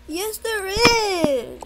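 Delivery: loud, slow wailing sob, pitched high; crying out loud. Acted. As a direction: wail it like a scared kid refusing school. Son cries at mom.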